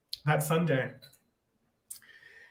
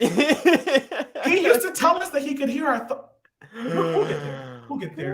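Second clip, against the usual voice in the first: laughing; 9 semitones higher, slower than usual. Real, not acted. Laughing through a wild claim.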